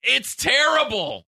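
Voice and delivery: high, drawn-out mock outrage; retold exasperated complaint. Host complaining to co-worker about the smell, mock outrage. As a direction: reenact protesting slowly and way up high.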